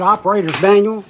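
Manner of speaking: ordinary talk, nothing marked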